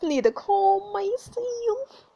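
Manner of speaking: high, very slow half-crying plea to herself; whimpering repeat. Real, not acted. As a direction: stretch it out like she's about to cry. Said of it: "I need to calm myself".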